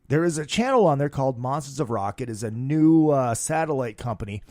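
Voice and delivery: normal talking voice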